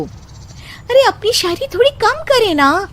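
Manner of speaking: long, high "अरे" then a plea; drawn-out complaint. Acted. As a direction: whine it out slowly. Wife complains to husband.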